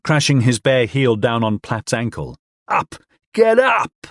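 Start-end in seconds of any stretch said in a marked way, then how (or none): 2.7-4.1 furious yell. Acted. Man kicking the drunk awake, furious yell. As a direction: scream him off the floor.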